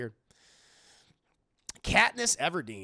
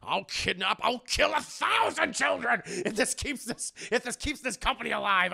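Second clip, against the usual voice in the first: imitating Waternoose yelling his evil plan; Waternoose villain line; 6 semitones higher than usual. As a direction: shout it like the boss losing his mind. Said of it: "Um, Mister Waternoose, I love him".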